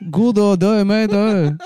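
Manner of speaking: high-pitched mock Chinese-Australian accent. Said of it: "Australian Chinese".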